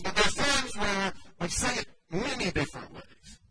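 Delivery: normal talking voice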